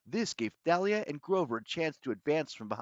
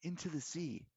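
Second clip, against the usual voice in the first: soft, high, hushed finish; quiet aside; 4 semitones higher, 9 dB quieter than usual. A narrator's reading. Hero finishing his plan for Bianca, hushed aside. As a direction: say it softly, pitched up. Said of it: "I told her quietly".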